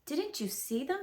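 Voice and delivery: lightly puzzled owl; a hint of surprise; a little surprised. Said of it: "a little surprised".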